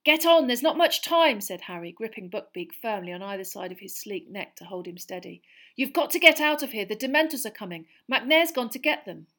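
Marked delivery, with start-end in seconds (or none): 0.0-1.4 high, loud and urgent; urgent plea. Acted. Urging Sirius to escape. 5.8-9.3 high, loud and urgent; urgent plea. Acted. Urging Sirius to escape.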